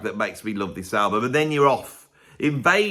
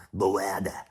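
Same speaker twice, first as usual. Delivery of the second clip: Kirk Douglas impersonation; 6 semitones lower, 5 dB quieter than usual. Pretend. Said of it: "That's my Kirk Douglas impersonation".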